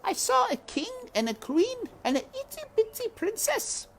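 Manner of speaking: put-on high child's voice; high, eager little girl voice; Meg insisting. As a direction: push it at him like a small girl. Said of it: "shouted Meg".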